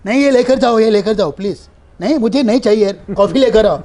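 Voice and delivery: loud, high set squabble; staged shout at the spot boy to end the call; loud fake argument. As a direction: shout over the caller to drown her out. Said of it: "चिल्ला रहा हूँ".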